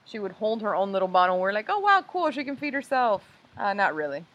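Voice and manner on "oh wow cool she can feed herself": mock excited. Parents' naive delight retold.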